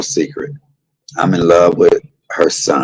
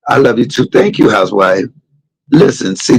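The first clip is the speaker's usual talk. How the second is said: normal talking voice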